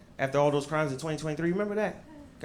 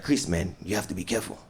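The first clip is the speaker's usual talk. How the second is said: worried dad; 5 semitones lower than usual. Retold or imitated. Dad on the phone warning his son. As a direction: warn your son gently and low. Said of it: "He's like".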